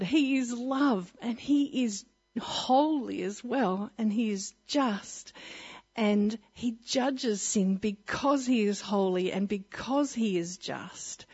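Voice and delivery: slow, every word weighted; slow, weighty. Acted. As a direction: preach it slowly and firmly. Solemn preaching point.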